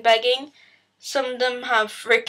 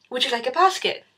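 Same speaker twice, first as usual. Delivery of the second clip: pushy shop assistant; faster than usual. Retold or imitated. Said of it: "they're like".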